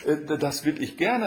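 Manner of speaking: high, stammering acceptance; flustered Katja. A narrator's reading. Katja stammers her consent.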